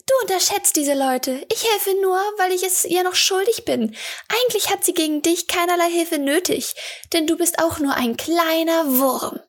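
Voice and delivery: put-on high young girl's voice, cool and prickly; a cheeky girl admitting she owes a debt; masked girl Eli, grudging. A narrator's reading. Masked girl to the water woman, high grudging girl voice.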